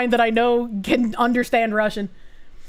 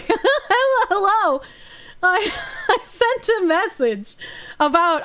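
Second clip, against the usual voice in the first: laughing greeting; 8 semitones higher, slower than usual. Real, not acted.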